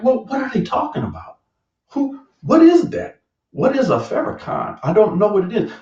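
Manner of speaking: high, bewildered questioning; high-pitched confused asking; baffled questions. Retold or imitated. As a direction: sound totally lost and pleading. Baffled, replaying his confusion.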